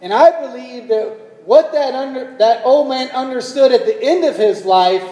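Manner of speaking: loud, high preaching climax; a preacher driving home the point; fervent preaching. Real, not acted. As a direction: preach it with full force. Preaching at full force.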